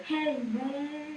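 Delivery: playful call. Retold or imitated. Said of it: "they would go".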